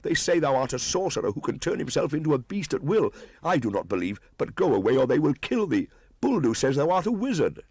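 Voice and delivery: mother pleading with her son in alarm; crying out in fear. Acted. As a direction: beg him to flee. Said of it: "Meswa ran across ... cried".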